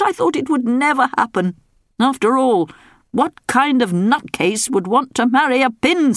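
high whining royal moan; moaning king.

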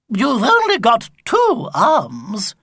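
high, loud, slow granny call; old lady shout. Acted. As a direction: point it out like a sharp granny. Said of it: "observed the horn-rimmed glasses".

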